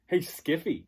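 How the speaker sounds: high, loud taunting call; bully sneering at the boy; mocking hello. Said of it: "he goes".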